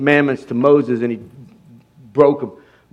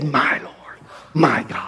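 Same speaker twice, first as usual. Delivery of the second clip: much softer, reverent; hushed awe; 3 semitones lower, 7 dB quieter, slower than usual. Acted. Thomas confessing to Jesus, hushed reverent awe. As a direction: breathe it in quiet awe.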